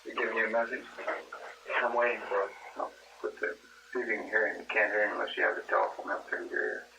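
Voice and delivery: usual speaking voice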